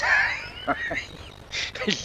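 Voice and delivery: breathy high laugh breaking through the story; real burst of laughter. Real, not acted.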